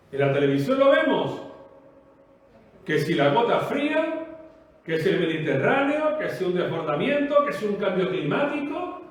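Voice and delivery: ordinary talk, nothing marked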